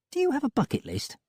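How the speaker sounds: high, light female voice; Molly's voice. A narrator's reading.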